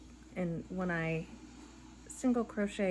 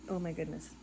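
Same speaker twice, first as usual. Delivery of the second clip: softer, lower weary oh my goodness; tired sigh; 3 semitones lower, 3 dB quieter than usual. Real, not acted. Tired crocheter sighs it quietly to herself. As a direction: sink into it, worn out. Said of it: "I'm tired".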